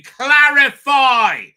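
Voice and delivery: slow shouted editing note; imagined editor's demand. Retold or imitated. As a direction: bark the note in the margin. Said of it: "I'll be".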